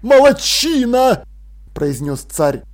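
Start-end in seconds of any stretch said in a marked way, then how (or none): 0.0-1.2 loud, high, drawn-out praise; hearty praise. Acted. King congratulates the apprentice, delighted. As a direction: proclaim approval with gusto.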